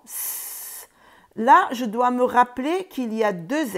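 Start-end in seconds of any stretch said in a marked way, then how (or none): 0.0-0.9 whispered hissing "S"; snake hiss. Shown as a demonstration. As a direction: let the S hiss out. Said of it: "comme un serpent". (S sifflé comme un serpent)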